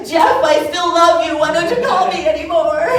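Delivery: loud, high lovesick wail; mock lovesick plea. Sign at the rally for the candidate, in a loud, high lovesick wail. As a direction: wail it like a jilted lover.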